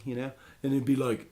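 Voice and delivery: normal talking voice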